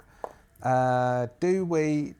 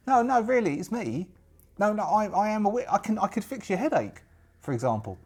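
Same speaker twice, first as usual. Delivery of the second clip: put-on high, earnest young woman's voice; insistent young witch; 8 semitones higher than usual. Witch convincing a doubting pirate, insistent high voice. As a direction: insist eagerly, pitched up.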